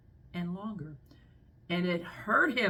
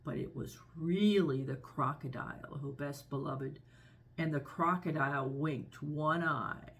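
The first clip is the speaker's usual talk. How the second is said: plain reading voice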